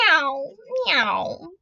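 high, loud imitated mewing; cat meow. A narrator's reading. Cat crying to the spider, high loud meows. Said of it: "cried the cat".

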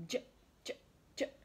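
"J" as a short j sound, no vowel; letter sound. Shown as a demonstration. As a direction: say "j" as the clipped phonics sound. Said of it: "Can you say that?".

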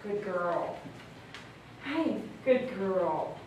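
low, soft, slow murmur of praise; calm the nervous dog with a hushed low voice; soothing dog praise. Real, not acted. Groomer soothing a dog while trimming her paws, low soft praise.

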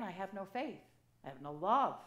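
usual speaking voice

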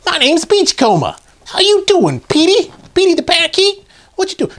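very high silly cartoon robot voice; put-on high-pitched character chatter; Beachcomber. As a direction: squeak it out as a playful toy. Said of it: "that's gonna be my voice for him".

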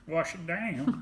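playful country singer voice, laughing. Retold or imitated. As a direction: lightly mimic a country star. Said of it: "As [name] would say".

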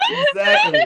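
high, loud, laughing "exactly". Real, not acted. Teller agreeing with friend, high laughing exclaim.

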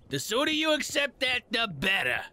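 ordinary delivery

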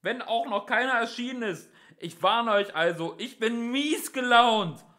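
high, loud, grumpy threat; touchy policeman's holler; shouted warning. A narrator's reading. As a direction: warn them at the top of your voice.

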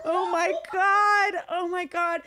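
high, loud cry of mock alarm, easing off; retold panic. Comic reliving losing the gun onstage, retold panic. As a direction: stretch the first one out in retold panic.

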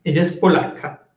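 ordinary delivery

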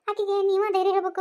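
usual speaking voice

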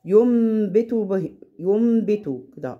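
ينبت said as يمبت, slow with a held ghunna; slow recitation demo. Shown as a demonstration. Teacher demonstrating iqlab on ينبت. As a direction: draw out ينبت, turning the noon into meem. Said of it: "بس هو مش حاطط علامة الميم".

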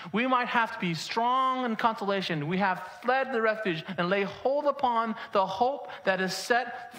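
emphatic sermon delivery with stretched key words; preaching. Real, not acted. Pastor citing Hebrews to congregation, preaching emphatically. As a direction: proclaim scripture with measured force.

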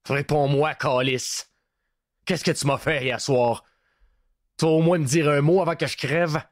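a tortured prisoner pressing for an answer; desperate captive. A narrator's reading. Captive prisoner demands answers from Bruno, desperately.

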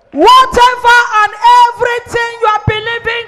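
loud, high declaration to the congregation; fervent, booming prophetic proclamation; full-force preaching. Real, not acted. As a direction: preach it out at the top of your voice.

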